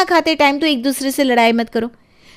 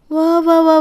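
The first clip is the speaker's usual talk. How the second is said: sarcastic applause; 5 semitones higher than usual. Acted. Sarcastic retort in quarrel.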